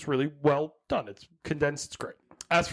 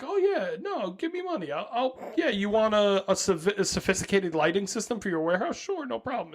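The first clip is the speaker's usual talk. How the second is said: breezy Tesla; 4 semitones higher than usual. Retold or imitated. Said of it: "he also just kinda was like".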